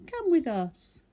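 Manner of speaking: small high eager animal voice; Little Beaver voice. Acted. Little Beaver asking new animals to be friends, high eager voice. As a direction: play a hopeful little beaver, bright and high.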